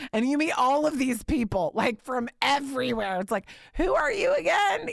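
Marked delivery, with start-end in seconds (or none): 3.8-4.9 baffled question. Retold or imitated. Meeting endless strangers at candidating.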